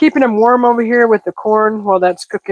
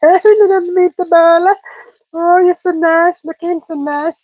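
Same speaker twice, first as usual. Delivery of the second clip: high, slow coo at the meatball; cooing at a sassy little meatball; cooing baby talk; 7 semitones higher than usual. Acted. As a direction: talk to it like a cute pet.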